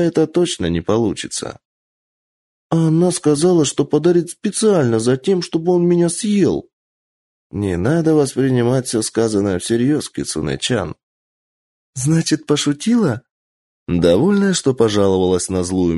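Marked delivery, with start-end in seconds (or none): 2.7-6.7 put-on high, aggrieved child voice; tattling like a small child; complaining little girl. Child complains about gift. 11.9-13.2 put-on hopeful little girl. Child asks hopefully.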